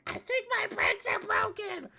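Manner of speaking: very high squealed imitation; hammed-up falsetto alarm; mock panic. Acting out panicked friend's cry.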